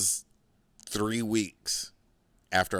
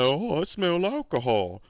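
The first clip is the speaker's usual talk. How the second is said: a higher, prissy landlord voice; imitating the landlord; 8 semitones higher than usual. Landlord catching a tenant, nosy put-on voice. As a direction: sniff out the booze like a nosy landlord. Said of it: "he'd be like".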